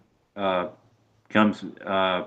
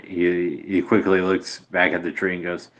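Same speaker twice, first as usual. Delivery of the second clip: normal talking voice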